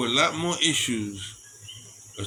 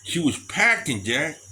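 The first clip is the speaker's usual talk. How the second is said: usual speaking voice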